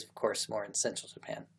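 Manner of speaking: ordinary delivery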